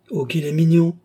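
high, loud cry of admiration; delighted exclamation. A narrator's reading. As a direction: exclaim over a sweet baby. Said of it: "s'écria la paysanne".

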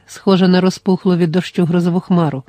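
usual speaking voice

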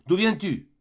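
high, loud cry down the stairs; shouted question. Acted. Mother shouting at her late son from the stairs, loud cry. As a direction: call it out sharply, high and loud. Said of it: "me cria-t-elle".

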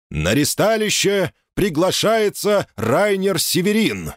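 slow, high booming announcement; town crier. Acted. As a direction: call it out like a herald. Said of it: "объявил местный глашатай".

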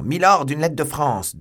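high, loud urgent call; excited messenger's shout; shouted announcement. A narrator's reading. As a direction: burst in crying out the news. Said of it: "Patrick entrait dans la salle en criant".

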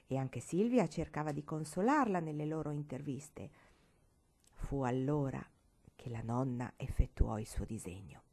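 ordinary delivery, nothing marked

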